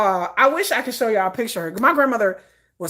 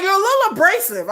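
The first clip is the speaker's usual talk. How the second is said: high, loud retold jab; teasing criticism; 6 dB louder than usual. Brothers tell their sister she's abrasive, teasing.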